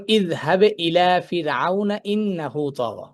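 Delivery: slow chanted ইযহাব ইলা ফিরআউনা; Quranic recitation. Shown as a demonstration. Teacher reciting the verse for students, slow chant. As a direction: recite the verse slowly and melodically. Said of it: "যেমন".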